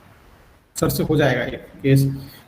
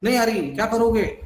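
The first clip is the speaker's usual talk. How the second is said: higher, defiant mock retort to her husband; defiant wife; 6 semitones higher than usual. Doctor imitates the husband imitating his defiant wife, higher. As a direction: throw the refusal back, a touch higher. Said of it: "और क्या बोला".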